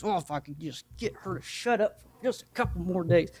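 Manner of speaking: lightly voiced fed-up neighbor; a hint of exasperation; exasperated atheist. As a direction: grumble it as an annoyed neighbor. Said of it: "He goes".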